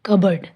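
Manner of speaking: कबर्ड with no P, short reduced ending; silent P. Shown as a demonstration. Teacher repeating the correction, कबर्ड with silent P. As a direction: say कबर्ड as one smooth word. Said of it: "इसमें पी silent है".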